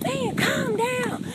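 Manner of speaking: high, drawn-out plea; exasperated pleading. Retold or imitated. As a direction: stretch it out to rein someone in. Sisters grabbing and calming the teller.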